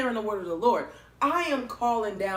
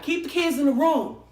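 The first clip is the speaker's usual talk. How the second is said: loud, higher panicked command; freaked-out warning to the family; urgent order; 6 semitones higher, 6 dB louder than usual. Retold or imitated. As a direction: bark it at your brother in alarm. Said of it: "I was like".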